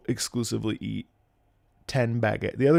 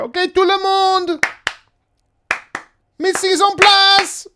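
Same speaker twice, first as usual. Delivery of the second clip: high, loud imitation of a French announcer; sudden French call; 8 dB louder than usual. Streamer imitating French call to everyone, high shout. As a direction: call it out high to a whole room. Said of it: "all of a sudden you're here".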